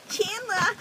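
put-on loud, high-pitched sitcom girlfriend call; Janice impression. Co-host imitating Chandler's girlfriend, high and loud. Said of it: "what's Chandler's girlfriend's name?".

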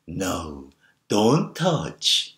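put-on gentle baby-talk murmur; cooing encouragement. Shown as a demonstration. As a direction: coo the warning as if it were praise. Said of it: "좀 속삭이듯, 그리고 격려하는 목소리 톤으로".

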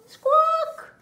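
shrill high squawk, loud; high bird squawk. Acted. Bird noise in the morning.

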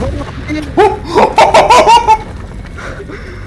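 long high laughing fit. Real, not acted. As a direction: burst out in helpless laughter.

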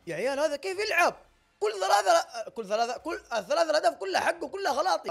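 loud, high-pitched protest; exasperated outburst. Real, not acted. Player complaining to viewers about three conceded goals, exasperated outburst. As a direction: protest loudly, voice pushed right up.

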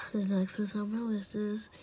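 normal talking voice

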